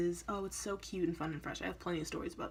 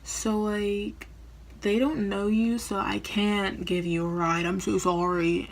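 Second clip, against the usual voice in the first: friend cancelling; 3 semitones higher, 11 dB louder, slower than usual. Retold or imitated. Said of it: "And she's like".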